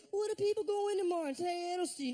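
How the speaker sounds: fast, high mock rant; furious. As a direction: imitate a friend's agitated worrying. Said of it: "Harris got fucking furious".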